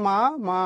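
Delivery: "মা মা" with a stop after the first "মা"; each "মা" said on its own, teacher demonstrating; pause between syllables. Shown as a demonstration. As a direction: break "মা মা" into two separate syllables. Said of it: "থামা লাগছে না".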